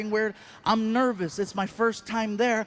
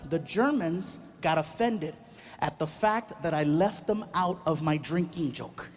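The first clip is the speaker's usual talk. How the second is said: usual speaking voice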